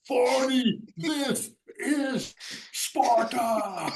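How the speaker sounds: high, slow, drawn-out mock war cry; Spartan battle cry. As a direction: ham up the movie Spartan's yell. Drunk teammate imitating 300 movie.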